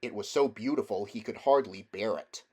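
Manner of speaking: ordinary delivery, nothing marked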